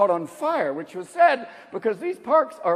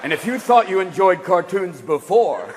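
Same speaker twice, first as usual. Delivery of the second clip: warning label voice; 7 semitones lower than usual. Retold or imitated. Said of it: "It's gonna have to say".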